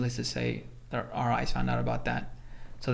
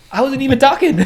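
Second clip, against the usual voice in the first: a much higher, louder "I wasn't even talking" through laughter; laughing protest; 12 semitones higher, 13 dB louder than usual. Real, not acted. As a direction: laugh at the unfairness of it.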